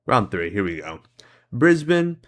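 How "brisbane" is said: Brisbane called out high and loud; excited recognition. Real, not acted. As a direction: blurt out Brisbane as you spot it. Recognizes the place instantly.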